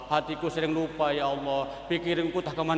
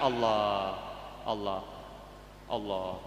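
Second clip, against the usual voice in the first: much lower and softer, fading Allah; fading dhikr; 9 semitones lower, 9 dB quieter, much slower than usual. Shown as a demonstration. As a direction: let each Allah die away to a breath. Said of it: "ya dikecilkan". (zikir makin pelan dan lirih)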